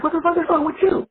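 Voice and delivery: high-pitched mock indignation; exasperated wife. Wife asks husband who is walking around yelling, mock exasperated.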